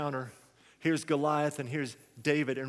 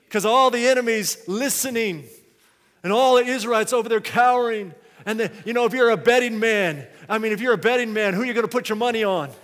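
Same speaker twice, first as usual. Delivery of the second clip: loud, higher, excited pulpit storytelling; full-force preaching; 6 semitones higher, 10 dB louder, faster than usual. Real, not acted. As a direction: shout the scene out to the congregation.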